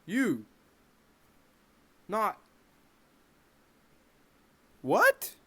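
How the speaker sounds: halting, rising to a loud, high what; shocked stammer ending in a loud, high what; stunned disbelief. Retold or imitated. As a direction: stammer in shock, then yelp it. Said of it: "sitting here thinking".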